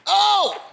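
high shouted oh; excited shout. Real, not acted. Preacher crying out to the congregation. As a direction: burst out in a cry.

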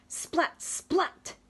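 high loud splat. Acted. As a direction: pop the splat like raindrops. Rain sound.

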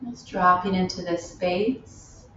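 unhurried meditation guide with long pauses; spacious, measured yoga-teacher delivery; guided meditation. Real, not acted. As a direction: lead them gently inward, phrase by phrase. Leads a loving kindness meditation.

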